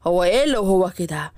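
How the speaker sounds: low, puzzled fatherly question; a dad demanding an answer; father's voice. Acted. Father presses daughter.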